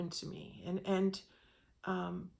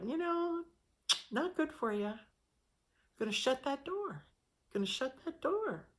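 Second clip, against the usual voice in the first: soft, higher, kindly final; gentle voice of God; 5 semitones higher, 4 dB quieter than usual. Acted. God gently refusing the wanting person, soft and high. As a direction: tell them no, tenderly, as God. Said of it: "God very gently saying".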